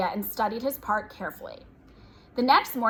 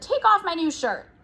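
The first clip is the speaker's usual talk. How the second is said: higher, insistent order; quarrelsome demand; 6 semitones higher than usual. A narrator's reading. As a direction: demand it back crossly. Father orders in acted quarrel.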